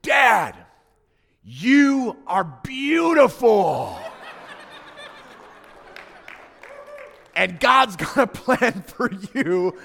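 put-on high, loud and slow child's declaration; confident little girl. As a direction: proclaim it like a six-year-old sure of herself. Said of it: "she said".

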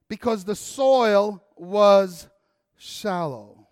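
slow, weighty parable reading; scripture reading. Acted. Pastor reading the parable to the church, slow and solemn. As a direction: read it slowly, letting it fade.

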